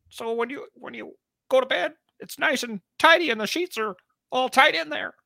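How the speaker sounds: very high, put-on lecturing voice; squeaky-high mock mentor; Jordan impression. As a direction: give earnest advice as a high-pitched caricature. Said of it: "his inflection on his words is just like".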